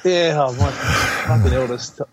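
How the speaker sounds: ordinary talk, nothing marked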